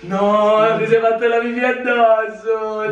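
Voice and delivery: high, laughing through the words. Real, not acted. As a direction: giggle while teasing him. Laughing after cold water prank.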